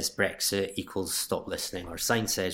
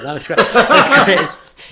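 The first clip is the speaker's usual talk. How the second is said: high, loud burst through laughter; real amused laughter in the voice; laughing; 12 semitones higher, 15 dB louder, much slower than usual. Real, not acted. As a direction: crack up while saying it. Joking about his imagined Scottish accent.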